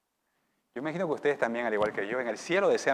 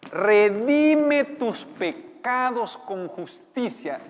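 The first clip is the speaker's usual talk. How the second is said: proclaimed; 9 semitones higher, 12 dB louder, much slower than usual. Acted. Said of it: "le dice".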